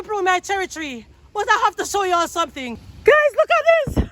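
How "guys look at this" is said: high, loud, thrilled call; real excited yell; excited shout. Real, not acted. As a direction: burst out with delight. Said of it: "sorry to be shouting in your ears".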